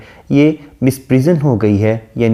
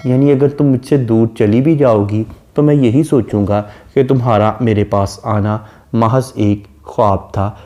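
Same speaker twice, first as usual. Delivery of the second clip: normal talking voice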